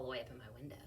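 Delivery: fading to a whisper; whispered end. Acted. Rapunzel complaining softly, fading to a whisper. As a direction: trail off into a whisper. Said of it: "And she says".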